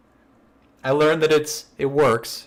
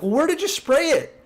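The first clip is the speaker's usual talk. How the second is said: very high, squeaky incredulous whine; incredulous coworker; 13 semitones higher than usual. Retold or imitated. Coworker protesting to the prankster, very high squeal. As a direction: squeal it like you can't believe it.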